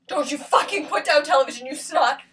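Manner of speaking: forceful, indignant scolding; full-voiced, cursing rebuke; angry outburst. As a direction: snap back in open anger. Angry outburst after quiet warning.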